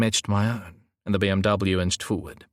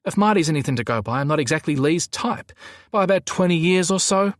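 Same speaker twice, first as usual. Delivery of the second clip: higher, quick huffy retort; huffing; 8 semitones higher than usual. A narrator's reading. Gazza says he's not Lee's type, huffing. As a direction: huff it out, pitched up. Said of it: "Gazza huffed".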